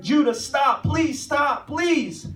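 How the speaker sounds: a father desperately calling out, re-enacted; retold screaming. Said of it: "I'm screaming as a father".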